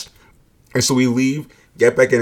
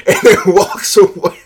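higher and louder through laughter; laughing; 8 semitones higher, 7 dB louder than usual. Real, not acted. Laughing while retelling her reaction.

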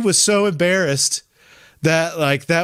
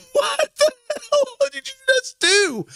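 much higher, slow, through laughter; high laughing disbelief. Retold or imitated. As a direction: laugh out the question in shock. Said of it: "I was like".

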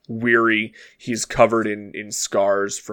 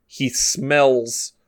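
ordinary delivery

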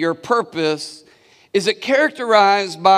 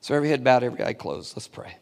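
hushed call to prayer; 6 semitones lower, 6 dB quieter, much faster than usual. Real, not acted. Pastor hushes the congregation before prayer, fading softer. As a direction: lower and much softer, fading out.

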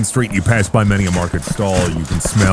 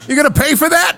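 demanding merchant; faster than usual. Acted. GM voices merchant demanding payment.

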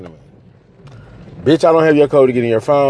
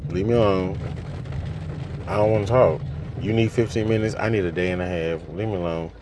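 much softer, low, shutting down; quiet withdrawal; 5 semitones lower, 8 dB quieter than usual. Real, not acted. Telling her partner to leave her alone, much softer. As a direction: pull back and go quiet, drained.

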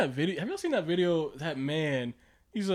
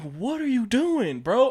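higher, drawn-out incredulous challenge; mock disbelief; 6 semitones higher, 5 dB louder, slower than usual. Diner confronting a plate-stacking waiter, mock incredulous. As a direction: play at calling him out, pitched up.

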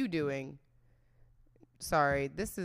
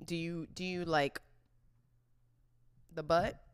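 ordinary talk, nothing marked

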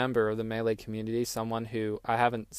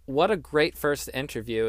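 usual speaking voice

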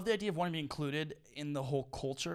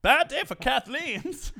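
laughing announcer; 12 semitones higher, 9 dB louder, much slower than usual. Acted. Mock news announcement, laughing.

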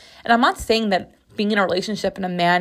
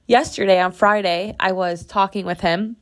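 normal talking voice

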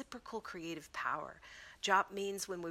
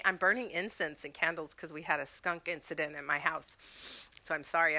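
usual speaking voice

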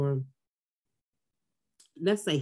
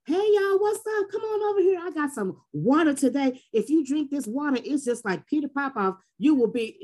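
hyping up a crowd; 7 semitones higher than usual. Retold or imitated. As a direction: call out to passersby like a street seller. Acting out a street water seller.

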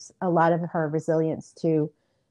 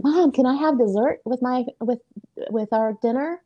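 put-on eager child asking; 7 semitones higher than usual. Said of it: "And she was like".

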